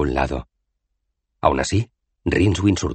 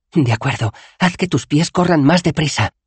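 irritable, raised-voice retort; bad-tempered; 11 semitones higher, 5 dB louder than usual. A narrator's reading. Bethan tells the would-be wizard to hurry, crossly. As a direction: suggest it crossly, rising higher and louder. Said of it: "sugirió Bethan de mal humor".

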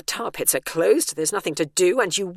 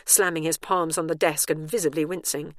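usual speaking voice

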